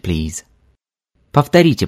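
ordinary talk

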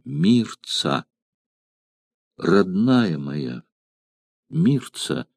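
soft, slow, fading call; faint dying voice. Acted. Dying Artorix calls Mirtsa faintly and slowly. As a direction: barely voice her name. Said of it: "отчётливо произнёс на этот раз умирающий".

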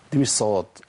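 usual speaking voice

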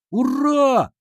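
high, loud drawn-out hurrah; cheering shout. A narrator's reading. Class cheering upcoming elections, high loud shout. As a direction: let a crowd's hurrah ring out. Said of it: "опять закричали мы".

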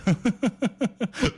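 a high, amused giggle. Real, not acted. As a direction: giggle at the joke. Laughs at his own joke.

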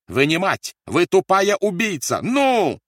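high, loud accusation; furious yelling. A narrator's reading. Officer accuses the mother to break her, furious yelling. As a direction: hurl abuse at full voice. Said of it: "заорал Рольф".

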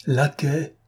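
high, bitter outcry of one word; a servant's humiliated cry; cried out. A narrator's reading. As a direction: fling the word out in wounded pride. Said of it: "s'écria-t-il".

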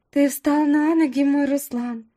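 high-pitched doting sweetheart; adoring girl. Acted. Lovestruck girl greets the injured man.

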